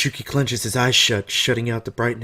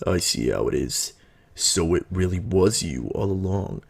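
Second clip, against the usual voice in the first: low, knowing character voice; lowered voice of calm realization; composed older man; 3 semitones lower than usual. Acted. Voices older Natsume in the story.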